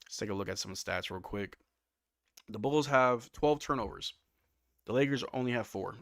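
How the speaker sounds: ordinary delivery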